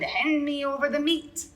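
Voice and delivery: high, loud greedy holler. A narrator's reading. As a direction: demand the meat at the top of your voice. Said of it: "we all hollered".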